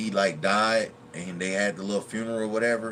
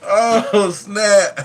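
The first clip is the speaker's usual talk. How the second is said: high, loud words through crying laughter; can barely talk for laughing; real helpless laughter; 13 semitones higher, 12 dB louder, much slower than usual. Real, not acted. Laughing hard at movie memory.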